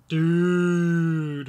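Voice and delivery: long, loud stretched dude; drawn-out dude. Shown as a demonstration. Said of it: "so this one here is".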